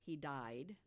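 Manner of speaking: ordinary delivery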